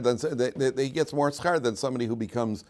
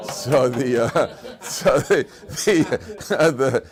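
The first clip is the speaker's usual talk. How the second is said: real laughter breaking into the words; louder, stumbling restart through laughter; laughing; 4 semitones higher, 7 dB louder, much slower than usual. Real, not acted. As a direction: try to get back on topic while laughing. Laughing after his own punchline.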